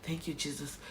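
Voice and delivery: soft, hushed repeated prayer; murmured thanks. Retold or imitated. Mother in surgery quietly thanking Jesus. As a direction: breathe the words like a prayer under your breath. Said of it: "kept on coming out from my mouth".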